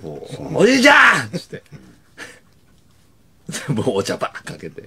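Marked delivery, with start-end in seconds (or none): 0.6-1.3 high, loud shout at grandpa; alarmed yell. Retold or imitated. Yelling at grandpa, burning futon.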